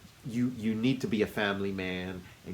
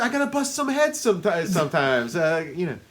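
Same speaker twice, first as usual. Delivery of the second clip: much higher, put-on mobster voice; a hammed-up Mo Green; 6 semitones higher, 3 dB louder than usual. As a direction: brag like a Vegas tough guy. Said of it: "Mo ... what does he say".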